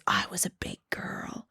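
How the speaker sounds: hushed, whispered quote; very soft mock whisper; Rachel. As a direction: say it almost voiceless. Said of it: "The way she calls her".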